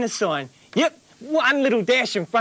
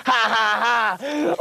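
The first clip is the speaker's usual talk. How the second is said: gloating laugh; much slower than usual. Acted.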